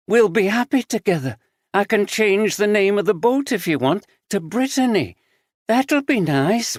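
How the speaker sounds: put-on cling to the girl with unstable longing; unhinged aunt. A narrator's reading. Unhinged aunt voiced by narrator.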